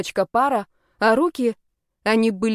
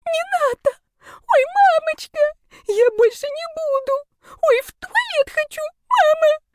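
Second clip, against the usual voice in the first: very high, whimpering squeal; tearful girlish shriek, far above your usual pitch; terrified wailing; 5 dB louder than usual. A narrator's reading. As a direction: wail in hammed-up terror, pleading for mercy. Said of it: "Девицы продолжали голосить".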